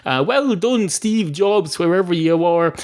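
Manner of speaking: high-pitched, grateful enthusiasm; thrilled congratulations, voice lifted high; delighted praise. Acted. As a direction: heap praise on an absent inventor. Dog gratefully praises Steve Jobs.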